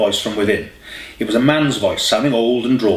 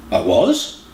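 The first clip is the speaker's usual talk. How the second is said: confused. A narrator's reading. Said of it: "confusion in his voice".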